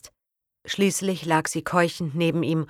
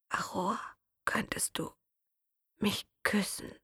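faint, fading whisper; barely audible; 4 semitones higher, 10 dB quieter than usual. Acted. Dying Matteo asking Aroa for a kiss, faint whisper. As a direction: ask it with the last breath. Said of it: "erahnte seine Worte mehr, als sie zu hören".

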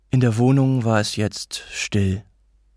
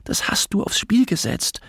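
higher-pitched, mounting reproach; mother's energetic, contained blame; suppressed arguing; 6 semitones higher than usual. A narrator's reading. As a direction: build to a pointed accusation. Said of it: "energisch, jedoch mit unterdrückten Stimmen".

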